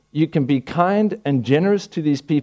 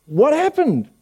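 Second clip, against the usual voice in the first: incredulous; 11 semitones higher, slower than usual. Acted. Incredulous question to former member.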